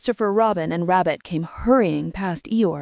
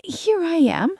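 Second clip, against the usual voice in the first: much higher, bright little squeak; squeaky Roo; 10 semitones higher than usual. A narrator's reading. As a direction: pipe up like a tiny child. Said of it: "squeaked Roo".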